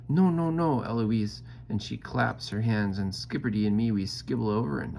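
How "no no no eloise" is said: loud, high scolding; nanny yelling as they rush in. A narrator's reading. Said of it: "Nanny comes in yelling".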